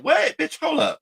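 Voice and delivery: high-pitched, disbelieving interruption; incredulous outburst. Retold or imitated. Reacting to his "same boat" excuse, incredulous. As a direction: jump way up in pitch to stop them short. Acts out own shocked reaction.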